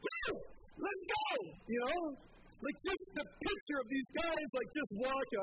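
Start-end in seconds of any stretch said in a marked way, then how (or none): 0.1-1.3 high, hyped-up whoop; excited cheer. Retold or imitated. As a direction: cheer it out like a fan. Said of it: "I'm like".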